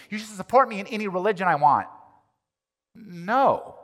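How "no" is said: ordinary talk, nothing marked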